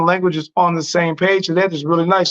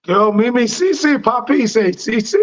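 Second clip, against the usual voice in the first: higher, playful fake Spanish babble; 5 semitones higher than usual. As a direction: toss off mock Spanish words for fun. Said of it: "That's not real Spanish".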